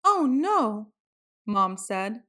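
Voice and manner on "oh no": mom's dismay. A narrator's reading. Mom dismayed at plaid shirt.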